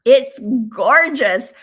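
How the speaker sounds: slow, loud, high gorgeous; gloating delight. Real, not acted. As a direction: savour it to make them jealous. Gloating about Arizona weather.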